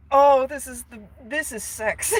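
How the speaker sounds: high mock rapture; overawed Phantom. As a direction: gush like a hammed-up lovesick Phantom. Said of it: "He's like".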